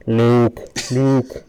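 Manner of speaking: loud call into a cup; booming Luke through a cup. Shown as a demonstration. Host doing Vader's voice into a cup, loud and booming. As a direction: echo the name into a cup like Vader. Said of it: "Can I do this?".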